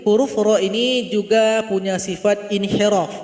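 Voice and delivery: ordinary talk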